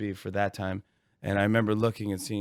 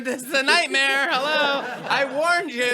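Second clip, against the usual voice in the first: hair guy scolding; 8 dB louder than usual. Retold or imitated. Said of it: "the hair guy's going".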